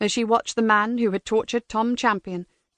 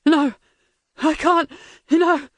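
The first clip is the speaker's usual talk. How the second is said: panicked pleading; 6 semitones higher, 4 dB louder, slower than usual. Acted. Prisoner begs under torture.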